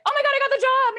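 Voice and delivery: very high, loud squeal of joy; ecstatic news. Retold or imitated. Daughter telling her mom she got the job, loud high squeal. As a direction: burst out with the big news.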